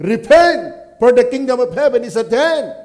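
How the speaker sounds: loud prophetic cry to repent; proclaiming call. Retold or imitated. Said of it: "crying in the wilderness, saying".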